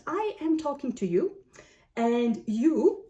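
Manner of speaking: ordinary talk, nothing marked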